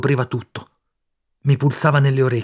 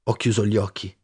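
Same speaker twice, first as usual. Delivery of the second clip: plain reading voice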